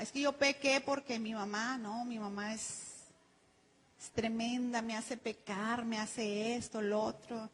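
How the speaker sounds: soft, mocking whine blaming mom; whining excuse. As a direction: play a sulky churchgoer blaming his mother.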